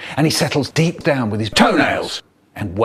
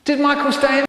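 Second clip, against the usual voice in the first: much higher mum's question; 12 semitones higher, faster than usual. Retold or imitated. Mum asking at the brother's door, high voice.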